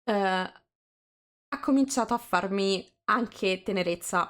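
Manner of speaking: normal talking voice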